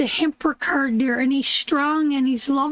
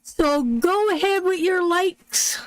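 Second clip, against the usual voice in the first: bright greeting; 5 semitones higher than usual. Real, not acted. Greeting a viewer on live stream.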